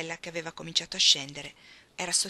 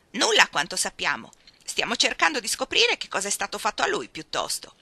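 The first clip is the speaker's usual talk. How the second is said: a thin, screechy officer, much louder; shrill voice; 2 semitones higher, 8 dB louder than usual. A narrator's reading. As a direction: squeeze the voice thin and loud. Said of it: "rispose con voce stridula". (voce stridula, molto più forte)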